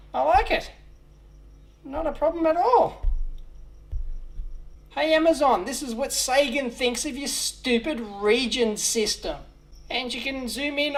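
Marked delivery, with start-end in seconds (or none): none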